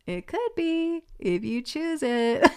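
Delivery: high, slow, teasing singsong; playful guides. Acted. Guides hinting at magical possibility, high playful lilt.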